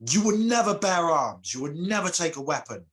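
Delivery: high, loud furious vow that eases off on the second line; angry curse. Acted. Goddess cursing the boy in fury, high and loud. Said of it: "but she's so angry".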